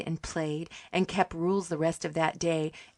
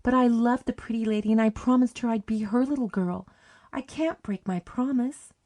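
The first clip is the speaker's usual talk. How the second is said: higher and louder, near tears; girl torn between two ladies, pleading; gasping plea; 6 semitones higher, 4 dB louder than usual. Acted. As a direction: plead with a catch in the throat. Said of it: "she gasped".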